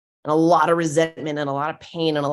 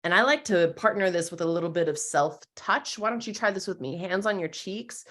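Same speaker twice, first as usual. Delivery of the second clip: normal talking voice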